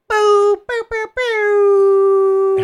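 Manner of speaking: loud, high jeering boos; hammed-up booing; mock booing. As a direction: boo like a heckler in a crowd. Mock booing his own picks.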